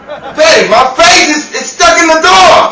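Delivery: high, loud panicked cry for help; panicked yelling. Acted. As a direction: yell out, stuck and desperate. Face stuck in door, panicked.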